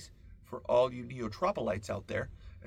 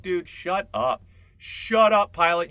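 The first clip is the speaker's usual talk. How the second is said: louder and higher, the first words dragged slow; mock yell at pilot; 7 semitones higher, 12 dB louder, slower than usual. Passenger yelling at the pilot to shut up, loud. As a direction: yell irritably at the cockpit. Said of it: "and you're like".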